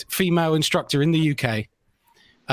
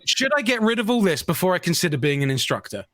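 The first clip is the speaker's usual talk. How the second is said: usual speaking voice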